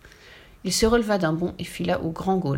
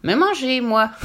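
higher, mocking witch; sneering; 5 semitones higher, 5 dB louder, slower than usual. A narrator's reading. Witch answering the ogre who wants to eat her, sneering. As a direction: scoff at the very idea. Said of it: "ricana la sorcière".

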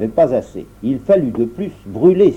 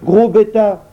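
higher, sharp sour scolding; the dwarf scolds the giant sourly, higher; sour retort; 8 semitones higher, 4 dB louder, slower than usual. Acted. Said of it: "répondit aigrement le nain".

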